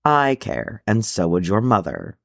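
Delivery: ordinary delivery, nothing marked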